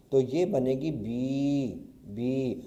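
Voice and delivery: slowly sounding out بی as a long "bee"; slow sound demo. Shown as a demonstration. Teacher to students, slow long بی. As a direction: draw out بی with chhoti ye. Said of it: "چھوٹی یائے کو بھی لگا دیں گے".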